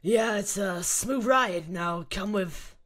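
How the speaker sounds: slow, heavy soldier voice; Krauser voice. Acted. Krauser coaxing Ashley into the car, slow and heavy. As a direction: drag each word out like a brute. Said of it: "the Krauser voice".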